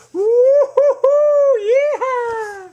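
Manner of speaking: very high, loud cowboy holler; joyride whoop. Pretend. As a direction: whoop like a monkey on a runaway cart. George racing down the ramp.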